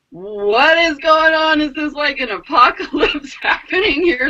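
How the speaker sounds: incredulous alarm. Retold or imitated. Storyteller reliving an apocalyptic storm, incredulous. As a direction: wail it in comic mock panic.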